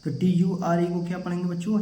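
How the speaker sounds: ordinary talk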